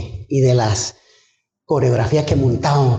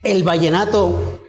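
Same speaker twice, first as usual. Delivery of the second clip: higher, rising preacher's fervour; fervent preaching; 8 semitones higher than usual. Acted. Preacher exhorting the congregation, raised fervent voice. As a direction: preach it to the congregation with mounting conviction. Preaching his baptism testimony.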